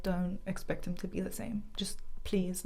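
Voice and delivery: softly whispered warning from the nurse; gentle whisper. Retold or imitated. Nurse gently preparing the family for the worst, whispered. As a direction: hush it like breaking bad news gently.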